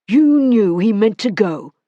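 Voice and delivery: a man gripping her wrists, in cold frenzy. Acted. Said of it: "in a cold frenzy".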